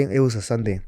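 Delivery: ordinary talk, nothing marked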